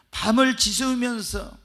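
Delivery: usual speaking voice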